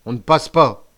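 loud, gruff refusal; growled warning. A narrator's reading. Guard stopping the prisoner, growling. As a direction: block the way with a growl. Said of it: "grogna la crique".

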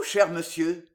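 ordinary delivery, nothing marked

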